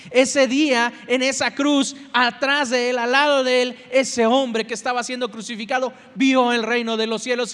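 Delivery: loud, ringing sermon delivery; full-force preaching. Acted. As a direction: preach it out to the congregation.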